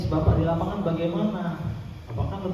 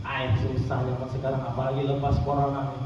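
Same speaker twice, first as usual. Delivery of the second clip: grumbling complainer; 8 semitones lower than usual. Acted. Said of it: "ketemu orang yang ngeluh".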